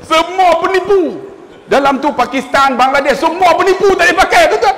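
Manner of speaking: high, loud mock outrage; shouted, laughing indignation; comic outcry. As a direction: cry out at being fooled. Comic outcry about all the fakers.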